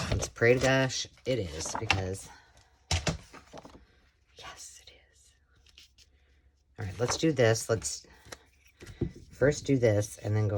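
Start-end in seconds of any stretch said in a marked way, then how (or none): 4.4-5.3 soft unvoiced whisper. Real, not acted. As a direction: breathe it to yourself. Whispered answer to herself.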